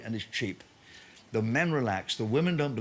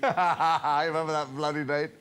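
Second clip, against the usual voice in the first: ordinary delivery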